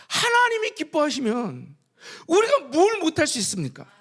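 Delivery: loud, high preaching climax; full-force preaching. Acted. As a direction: preach it out with fervor to the congregation. (힘차게 외치는 설교)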